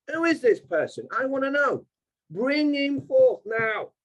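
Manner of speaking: high, slow, imperious bring him forth; indignant demand. Pretend. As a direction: demand it like a king summoning a servant. Sovereign demanding the credit rater.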